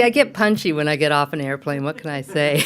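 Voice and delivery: ordinary talk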